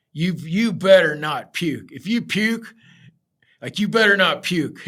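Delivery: slow threatening boss, don't you dare; mock angry warning. Boss warning the new guy not to vomit, mock stern shout. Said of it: "he's like".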